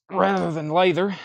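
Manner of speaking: straining while lifting a heavy crate; grunting with effort under the weight. Real, not acted. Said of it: "Sorry for the grunting".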